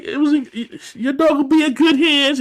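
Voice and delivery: high sweet-talking voice; mock sweet reassurance; sugary reassurance. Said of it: "she's like".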